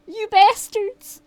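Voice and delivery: normal talking voice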